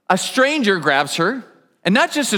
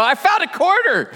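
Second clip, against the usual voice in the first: much higher, delighted little cry; excited find; 9 semitones higher than usual. Retold or imitated. Broke newlyweds finding a coin in the couch, excited. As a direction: pop up thrilled at the find.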